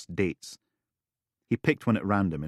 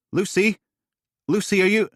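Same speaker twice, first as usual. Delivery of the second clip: alarmed calling; 13 semitones higher, 8 dB louder than usual. Acted. Duncan alarmed.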